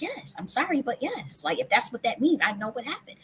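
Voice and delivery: high-pitched, defensive insisting; high, earnest protest of a child; childlike insistence. Retold or imitated. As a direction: pitch up like a kid standing her ground. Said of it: "I'm like".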